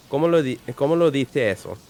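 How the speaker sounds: Castilian Spanish with dice said as "diθe", a lisped th sound; Spain Spanish pronunciation. Shown as a demonstration. Streamer showing chat how Spain Spanish sounds, Castilian lisp. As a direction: say "¿Cómo lo dice eso?" the Castilian way, c of dice as th. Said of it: "That's how you'd say it in, like, fucking Spanish".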